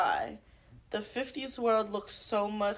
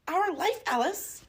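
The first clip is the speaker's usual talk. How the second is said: lightly acted, high questioning lilt; mock-earnest plea with rising endings; Fred Armisen; 8 semitones higher, slower than usual. Comedian pleading with Alice in the movie, lightly hammed-up impression. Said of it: "Fred Armisen".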